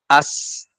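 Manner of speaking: "As" with a long streaming s; flowing sound. Shown as a demonstration. Teacher shows flowing letters, "As" sustained on s. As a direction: keep the s of "As" hissing on. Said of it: "tapi tetap masih ngalir".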